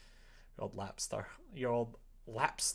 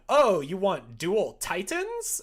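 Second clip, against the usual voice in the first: slow, louder upsell offer; mock upsell pitch; 6 semitones higher, 9 dB louder, slower than usual. Friend mocking the store site: slow, raised mock pitch. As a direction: tease the pushy store offer. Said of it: "their high tier ones were like".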